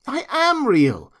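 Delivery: high, slow, loud, leaning on "am"; insistent protest. A narrator's reading. Toy rabbit answering the accusation, "am" stressed insistently. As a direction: stretch "am" high in indignant insistence.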